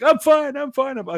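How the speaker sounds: very high imitated kid; retold frantic insistence. Kid just picked up after falling, imitated high insistence. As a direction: gabble reassurance up high, imitating the fallen kid.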